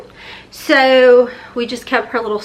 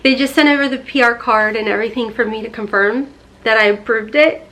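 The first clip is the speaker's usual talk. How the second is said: ordinary delivery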